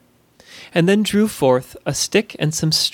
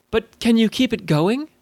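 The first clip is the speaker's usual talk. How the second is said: mildly worried question, pitched up; edge of concern; 6 semitones higher than usual. A narrator's reading. Alyssa asking if Zoe can keep it going, slightly concerned. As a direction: let a little concern edge in. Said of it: "a tone of concern edging into her voice".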